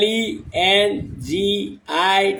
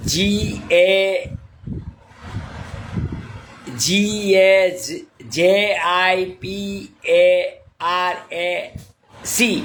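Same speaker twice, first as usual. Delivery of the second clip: each letter drawn out slowly; slow, careful spelling of a name; 3 semitones lower, much slower than usual. Shown as a demonstration. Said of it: "मैं spelling पढ़ लेता हूँ sir".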